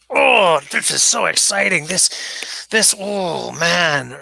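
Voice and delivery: overexcited axe nerd gushing; gushing excitement. Retold or imitated. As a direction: imitate himself getting carried away over an axe. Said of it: "I'm gonna be like".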